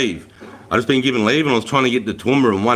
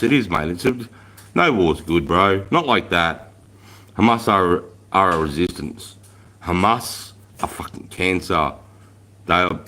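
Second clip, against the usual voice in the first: ordinary talk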